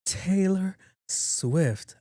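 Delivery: high, very slow mock wail; imitated crying. Friend finally names who got engaged, mock crying. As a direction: stretch each word into a put-on sob. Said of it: "Called me crying".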